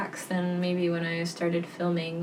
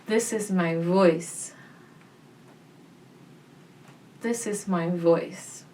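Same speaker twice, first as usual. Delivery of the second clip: slower, leaning on "voice" at the end; stress on voice. Shown as a demonstration. Voice teacher demonstrating different emphasis, stress on "voice". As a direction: land on the word "voice". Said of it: "let's try emphasizing different words".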